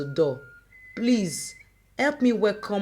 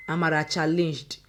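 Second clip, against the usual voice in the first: plain reading voice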